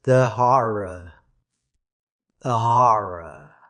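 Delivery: slow, low, haunted echo; menacing whispering echo. A narrator's reading. Dusk repeating a dying man's words, haunted and slow. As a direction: draw it out low and ominous. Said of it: "in a persistent whisper all around".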